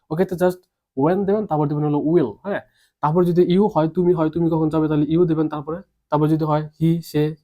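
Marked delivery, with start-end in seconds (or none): none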